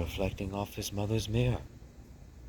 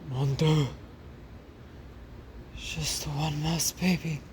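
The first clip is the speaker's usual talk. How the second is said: French mother mouse; 9 semitones higher than usual. Acted. Mother hearing only one baby survived, high and dramatic. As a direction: gasp it out in a high theatrical voice.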